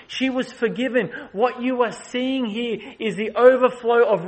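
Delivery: building sermon climax; preaching at full force. Acted. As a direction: preach it out to the congregation.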